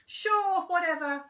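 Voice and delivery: high, loud, eager Henry; boy agreeing eagerly, high and loud. Acted. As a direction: jump at the deal. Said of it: "said horrid Henry eagerly".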